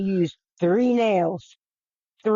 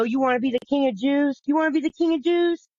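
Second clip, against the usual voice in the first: mocking taunt; 7 semitones higher than usual. Soldiers taunting Jesus while whipping.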